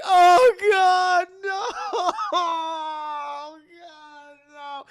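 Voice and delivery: hammed-up crying, high and drawn out; anguished wail. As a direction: wail in horror, loud then fading away. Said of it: "Father Marcus is like".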